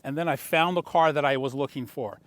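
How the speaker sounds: ordinary delivery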